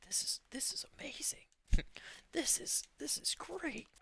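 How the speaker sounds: hushed, squeaky-high mock amazement; breath-light retold wonder; awed whisper. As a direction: whisper it, marveling at a discovery. Awed retelling of tasting the water.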